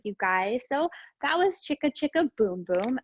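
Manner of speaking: normal talking voice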